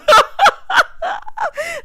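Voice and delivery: high, loud burst of cackling laughter; loud cackle. Real, not acted. Vlogger cracking up at her own impression, loud cackle.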